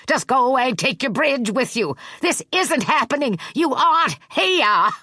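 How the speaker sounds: put-on high, loud frightened old man; terrified old janitor shrieking at the girl; panicked shriek. A narrator's reading. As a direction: cry out in terror, denying what he sees. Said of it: "he shrieked".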